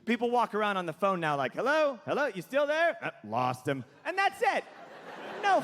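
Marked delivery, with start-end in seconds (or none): 1.5-3.0 higher, quick checking questions; phone hello. Retold or imitated. Caller checking if the other person is there, questioning. 3.0-3.8 lower, casual giving up; offhand dismissal. Retold or imitated. Caller giving up on a dropped line, offhand.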